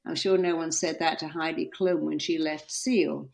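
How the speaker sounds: usual speaking voice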